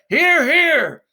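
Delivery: loud, high cheer of agreement; crowd cheer. Acted. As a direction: shout it out with the whole crowd. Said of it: "And then all together".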